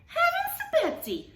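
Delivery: high startled waitress; exclaim. Acted. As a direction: exclaim it in alarm. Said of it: "she exclaimed".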